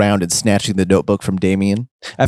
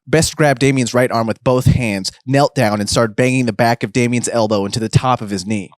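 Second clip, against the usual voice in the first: plain reading voice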